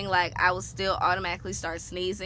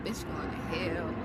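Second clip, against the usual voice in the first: muttered dread; 3 semitones lower, 6 dB quieter, slower than usual. Retold or imitated. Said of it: "I was like".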